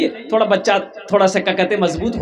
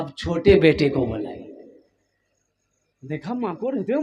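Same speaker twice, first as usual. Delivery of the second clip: normal talking voice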